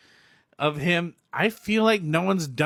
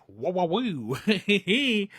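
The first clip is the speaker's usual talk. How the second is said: slow awed whoa; 4 semitones higher, much slower than usual. Retold or imitated. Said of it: "it's like".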